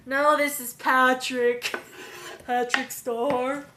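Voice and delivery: slow, loud cartoon answer; loud cartoon gag voice. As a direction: announce yourself loudly like a dopey cartoon.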